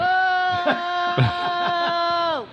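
long high giggle; high laughter. Real, not acted. Laughs at Adam Sandler.

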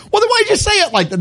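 exasperated retort. Retold or imitated. Patient mocking the doctor's tone, high and incredulous. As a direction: push back on the doctor in disbelief.

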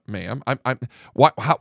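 ordinary talk

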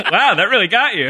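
high laughing burst; cracking up at the joke. Real, not acted. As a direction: laugh through the words.

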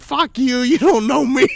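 high, loud mock outrage; retold yelling. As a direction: yell it down the phone in mock fury. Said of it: "I'm yelling at the phone".

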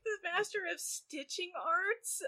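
high, slow puzzled question; confused question. Retold or imitated. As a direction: ask it baffled, drawing it out. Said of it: "I'm like".

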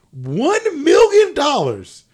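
loud, high, drawn-out prize reveal; dramatic announcement. Acted. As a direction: boom it out slowly like a jackpot.

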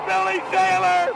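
usual speaking voice

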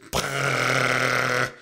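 low, sustained lip-buzz noise; imitated bathroom noise; long mouth-made raspberry. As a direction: blow a long fart noise. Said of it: "all he hears is".

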